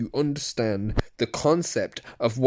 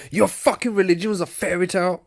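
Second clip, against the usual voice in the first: mock accusation; 8 semitones higher, faster than usual. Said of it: "they're going on like I was like".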